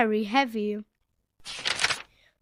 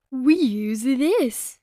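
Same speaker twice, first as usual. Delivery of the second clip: Daddy Pig; 4 dB louder, much slower than usual. A narrator's reading. Daddy Pig showing the list, slow. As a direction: show it off proudly. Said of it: "said Daddy Pig".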